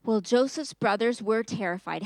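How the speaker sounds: ordinary delivery, nothing marked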